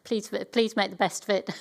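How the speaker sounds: ordinary talk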